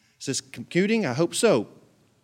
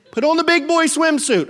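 weirder voice; 10 semitones higher, 5 dB louder than usual. Acted. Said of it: "I can make it weirder, see".

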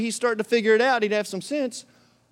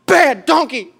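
loud, higher-pitched scolding; mock mad; 6 semitones higher, 5 dB louder than usual. Balaam gets mad at his donkey, mock angry. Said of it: "He gets mad".

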